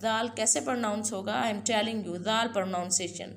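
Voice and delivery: normal talking voice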